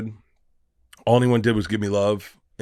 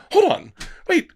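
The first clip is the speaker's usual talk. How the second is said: retold shock; 4 dB louder than usual. Shocked retelling of his reaction.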